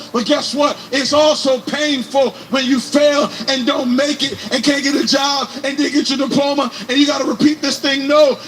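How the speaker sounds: ordinary talk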